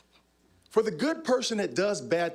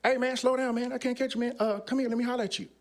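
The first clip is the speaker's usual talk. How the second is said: gang member calling; 8 semitones higher than usual. Retold or imitated. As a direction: call him over, voice pitched up. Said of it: "He goes".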